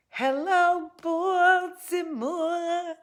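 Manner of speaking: high, drawn-out hello to the crowd; long, high-pitched stage call; mock arena greeting. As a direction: stretch it out high like a touring rock star.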